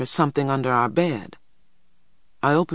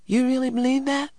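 put-on very high, hopeful child's question; 11 semitones higher than usual. A narrator's reading. Little boy seeks reassurance from his brother, hopefully. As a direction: ask it wanting a yes. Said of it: "asked Christopher John hopefully".

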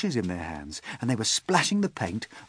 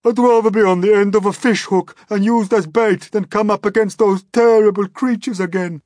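high, loud, terrified lament; panicked shrieking; 13 semitones higher, 13 dB louder than usual. A narrator's reading. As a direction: shriek in dread at full voice. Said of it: "shrieked the poor blind earthworm".

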